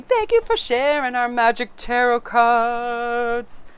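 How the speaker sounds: loud, slow, drawn-out character farewell; Edward. Acted. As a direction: put on a theatrical voice, stretching the last word. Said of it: "Edward wants to bid you a fond farewell".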